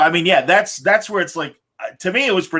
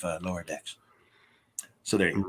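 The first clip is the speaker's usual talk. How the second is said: much lower and softer, lightly voiced Roddenberry; Gene Roddenberry; 8 semitones lower, 12 dB quieter, faster than usual. Host as Roddenberry finishing his pan, low and very soft. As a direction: let the words sink away in mock disgust. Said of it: "Majel and I had to".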